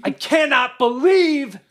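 high, strained re-enacted rant; angry yell. Venting alone in the car about an ex, angry yell. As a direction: yell it out with drawn-out, exasperated words. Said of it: "I yell in the car to no one".

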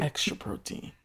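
soft unvoiced repeat of the joke; hushed deadpan echo; whispered echo. Real, not acted. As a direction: breathe it back as an aside. Echoing the joke under breath.

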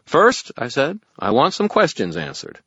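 loud, high call over clamor. Acted. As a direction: cut through the noise loudly.

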